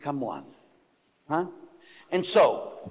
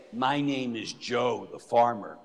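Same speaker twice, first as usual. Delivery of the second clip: lower, soft farmer voice; 6 semitones lower, 6 dB quieter than usual. Pretend. Priest mimicking a farmer introducing himself, lowered voice. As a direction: play a low, slow farmer. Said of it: "And you could say".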